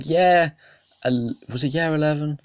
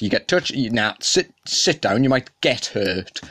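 ordinary talk, nothing marked

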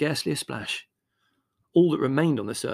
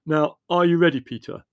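loud, raised-pitch check; calling out orders; 5 semitones higher, 9 dB louder than usual. A narrator's reading. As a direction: call to a helper before the job starts. Leslie calls to Peter.

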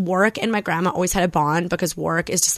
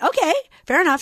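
quoting myself; 10 semitones higher than usual. Retold or imitated. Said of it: "I'm like".

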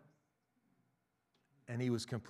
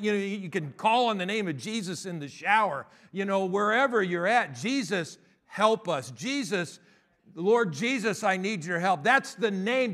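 loud, high, driving preaching; full-force preaching; 5 semitones higher, 6 dB louder than usual. Acted. Pastor urging the congregation to call on Jesus anywhere, loud preaching.

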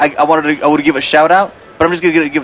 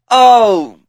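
louder, higher drawn "oh"; mock realization; 7 semitones higher, 4 dB louder than usual. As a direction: act out a sudden realization. Mock realization that someone is high.

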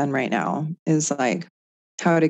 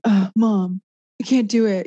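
higher-pitched mock whine of a kid; whiny child; 6 semitones higher, 4 dB louder than usual. Son complains to mom while reaching under the bed, mock whiny. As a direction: imitate a frustrated little son. Mother voices her struggling seven-year-old son.

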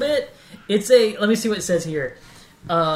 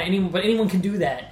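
ordinary talk, nothing marked

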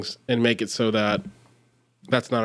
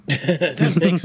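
slow, higher, laughing through the words; drawn out through real laughter; 4 semitones higher than usual. Real, not acted. Cohost laughing as he repeats the show's name.

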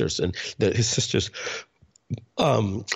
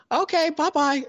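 a hammed-up nagging mother on the phone; squeaky put-on mom voice, much higher; imitating mom. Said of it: "And she'd be like".

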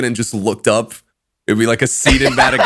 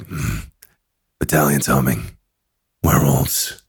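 Geralt; 10 semitones lower than usual. Retold or imitated. Friend retelling the acid trip as Geralt, deep gruff impression. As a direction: drop right down into a Geralt impression. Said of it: "We were like".

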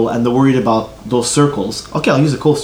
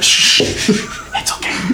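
soft hushing whisper; 5 semitones higher, 3 dB quieter, much slower than usual. Acted. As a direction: hush her gently, barely voiced. Acting out shushing the hostess.

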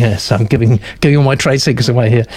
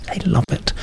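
whispered aside, very soft; conspiratorial whisper; 6 semitones higher, 8 dB quieter than usual. Real, not acted. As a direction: drop to a secret whisper. Aside about agents loving praise.

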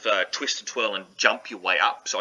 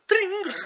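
ding sound effect; tiny high ding. Acted. GM voices spell sound effect.

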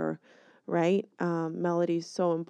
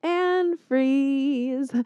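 called out; 8 semitones higher, 5 dB louder than usual. Acted. Repeats the freeze call.

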